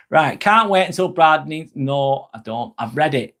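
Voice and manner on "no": ordinary delivery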